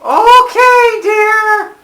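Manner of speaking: high, loud, drawn-out yell; calling from another room. Pretend. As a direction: holler the question out in a high voice.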